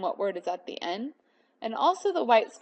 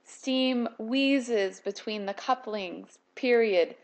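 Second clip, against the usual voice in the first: slow, deliberate poem reading; slower than usual. Shown as a demonstration. Teacher shows the class how punctuation slows the poem, reading slowly. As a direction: let "Steam wheezes between the couplings" drag slowly. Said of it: "the poem is slowed down".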